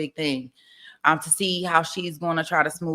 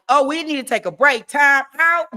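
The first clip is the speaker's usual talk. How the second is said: mock judge; 7 semitones higher, 5 dB louder than usual. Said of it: "The judge would be like".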